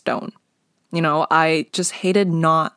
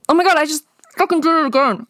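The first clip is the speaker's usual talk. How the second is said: high frustrated outburst; exasperated at herself; 8 semitones higher, 3 dB louder, much faster than usual. Real, not acted. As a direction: squeal in self-annoyance. Catches herself saying like again.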